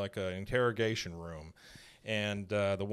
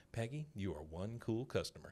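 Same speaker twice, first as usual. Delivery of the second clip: soft, self-satisfied aside; imitating a smug private remark; talking to herself; 9 dB quieter than usual. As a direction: quietly congratulate yourself. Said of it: "she says to herself".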